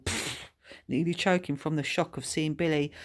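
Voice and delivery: ordinary delivery, nothing marked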